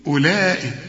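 أولئك pronounced ulaik, no w; silent waw. Shown as a demonstration. Teacher shows which letter to skip. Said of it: "الغي الحرف ده من النطق".

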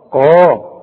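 a loud, full, back ق held on قا; heavy qaf. Shown as a demonstration. Teacher gives the qaf its due, heavy loud قا. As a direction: push out a thick emphatic قا. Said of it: "القاف".

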